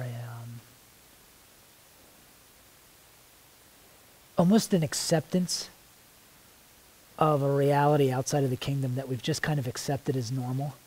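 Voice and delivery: earnest spoken prayer, drifting soft and hushed as it goes on; heartfelt prayer. Real, not acted. Praying to the Lord before the group, intimate and hushed. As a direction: pray it out, pausing to listen.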